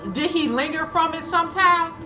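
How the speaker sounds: normal talking voice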